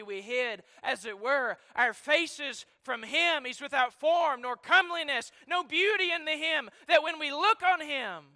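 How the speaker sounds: loud, high preaching climax; preaching at full force. Acted. Preacher proclaiming Christ's innocence to the congregation, loud and high.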